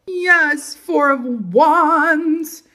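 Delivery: high, very drawn-out celebratory yes; elated cheer. Real, not acted. Tarot reader greets a favorite card, elated cheer. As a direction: savor every word in high delight.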